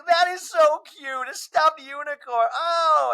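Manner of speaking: high, slow, cooing gush; fawning over a stuffed unicorn on FaceTime; sugary slow high coo; gushing Angie K. Retold or imitated. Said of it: "And Angie K's like".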